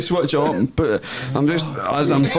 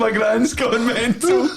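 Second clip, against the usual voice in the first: laughing; 11 semitones higher than usual. Real, not acted. Laughing while telling.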